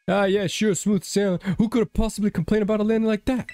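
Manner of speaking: high, fast sarcastic quip; dry sarcasm about a rough landing. Acted. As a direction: lay on the mock cheer. Sarcastic reply to game character.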